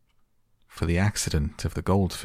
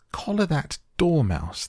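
high, imperious queen's shriek; high-pitched outburst, falling at the end; shrieked order; 11 semitones higher, 3 dB louder than usual. A narrator's reading. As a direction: shriek it out as a furious command. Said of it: "the queen shrieked out".

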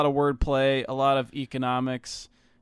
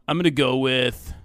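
ordinary delivery

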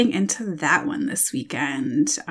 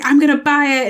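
higher, louder mimicked excitement; excited quote; 7 semitones higher, 5 dB louder than usual. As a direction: voice an eager bookseller. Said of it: "she was so excited".

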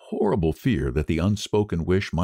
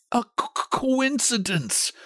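high, faltering stammer. A narrator's reading. Old man explains away the first wish, stammering. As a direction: catch on the first syllable, then drag it out. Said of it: "stammered the old man".

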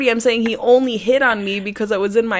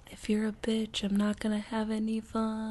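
imagined inner voice; 12 dB quieter than usual. Retold or imitated. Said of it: "my voice in your head".

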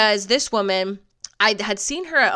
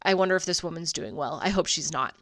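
normal talking voice

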